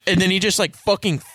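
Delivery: usual speaking voice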